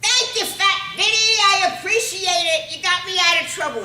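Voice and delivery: high, loud grateful shout; high-pitched boyish holler of thanks; yelling. Acted. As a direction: yell it up the fire escape like a kid.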